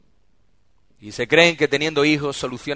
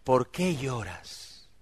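slow, much softer caring question; tender soft question; 2 semitones lower, 10 dB quieter, much slower than usual. Acted. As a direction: ask it gently, like you finally noticed. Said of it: "que le dijo".

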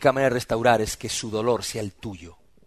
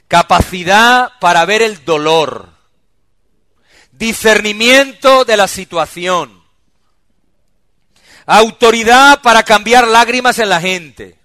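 slow, much louder and higher preaching; full-force preaching; 8 semitones higher, 14 dB louder, slower than usual. Real, not acted. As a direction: proclaim each point from the pulpit.

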